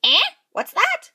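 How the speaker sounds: squeaky high, puzzled; half-deaf fairy mishearing; deaf old fairy. A narrator's reading. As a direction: crane an ear and squeak it. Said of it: "she said".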